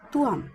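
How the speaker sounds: তুয়াম said softly and evenly, with a falling end; pronunciation demo. Shown as a demonstration. Teacher demonstrating pronunciation to students, soft তুয়াম. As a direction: show them how তুয়াম sounds. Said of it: "আমি উচ্চারণটা দেখিয়ে দিচ্ছি".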